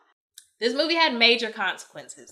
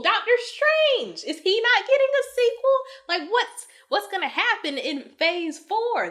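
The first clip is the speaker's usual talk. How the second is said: emotional disbelief; 11 semitones higher, 3 dB louder than usual. Real, not acted. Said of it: "I'm getting emotional".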